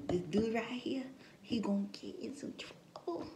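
voiceless, confiding whisper; hushed knowing aside. Real, not acted. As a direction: whisper it like gossip behind his back. Hushed aside about [name].

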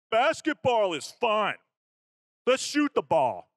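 slow, high and loud goofy voice; mocking dopey jock. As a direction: make the weak players sound dim. Mocking clumsy football players.

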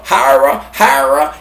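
"Hara" said HAR-uh, with an AR vowel; variant pronunciation. Shown as a demonstration. Host trying names for the garden knife, "Hara" as HAR-uh. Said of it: "whatever you wanna call it".

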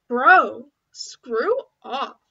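loud angry snap. Acted. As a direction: bark it at them. Snapping at someone.

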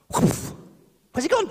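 high, loud startled question; hammed-up bewildered cry; mock astonishment. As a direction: act baffled he's vanished. Disciples' shock at vanishing.

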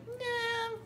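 high, drawn-out refusal as the dog; a long, high whine of refusal, imitating a pet; voicing a reluctant dog. Streamer speaking for the dog who won't come over, mock whine. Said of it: "Lucky's like".

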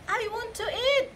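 put-on high, slow whiny kid; a child's high slow demand; hungry little boy. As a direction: ask for food like a small boy. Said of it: "David bilang".

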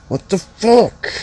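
sudden high squeal of disbelief; shocked shriek. Real, not acted. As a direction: yelp it out, stunned. Shocked reaction in game.

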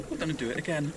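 ordinary delivery

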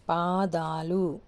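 very slow, stretched పాదాలు; long vowel. Shown as a demonstration. Teacher modelling the plural పాదాలు for learners, long vowels drawn out. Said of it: "పక్కనున్న లెటర్కి దీర్ఘం వస్తుంది".

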